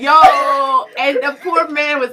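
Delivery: ordinary talk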